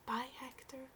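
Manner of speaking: soft unvoiced goodbye; a hushed whisper at the window. A narrator's reading. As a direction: breathe it out so she won't wake him. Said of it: "she whispered".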